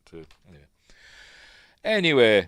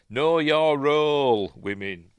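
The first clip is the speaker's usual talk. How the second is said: slow, louder, higher jokey order; mock-stern command; 6 semitones higher, 6 dB louder, slower than usual. Joking aside to women after the dinner line, mock-stern. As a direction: draw it out like a pretend telling-off.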